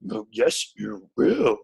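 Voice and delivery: put-on alien sage voice, swooping on the last words; Yoda. Shown as a demonstration. Host touring Frank Oz voices, Yoda impression. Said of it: "Here's Yoda".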